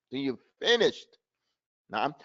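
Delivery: normal talking voice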